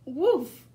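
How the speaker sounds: loud barked woof like a puppy; loud playful puppy woofs; dog bark. Acted. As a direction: bark it out like Biscuit. Dog barks in story.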